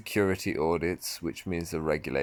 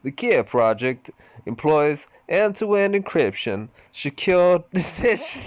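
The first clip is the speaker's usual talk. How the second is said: higher, louder hammed-up American accent; mock American accent breaking into a laugh; 9 semitones higher, 6 dB louder than usual. Shown as a demonstration. Reading Mister Yanamochi's answer in an American accent. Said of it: "I'm gonna say that in American accent".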